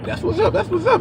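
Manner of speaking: high, airy "that's what's up"; breezy brush-off. Retold or imitated. Brushing off teammates who talk trash, airy and high. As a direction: float it up, unbothered and dismissive.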